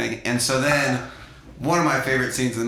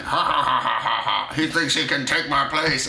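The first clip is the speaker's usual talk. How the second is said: hammed-up cackling old man; imitating a mad cackle; mock mad laughter; 2 semitones higher than usual. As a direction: laugh bitterly through a jealous rant. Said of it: "the guy's going like".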